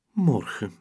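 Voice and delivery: repeated sadly, further off; a wistful second sigh; sad echo. Acted. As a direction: say it again to herself, lost. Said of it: "zucht ze dan verdrietig".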